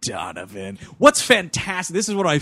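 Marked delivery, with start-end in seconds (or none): 0.0-0.8 mock mourning. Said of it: "the guy's just like".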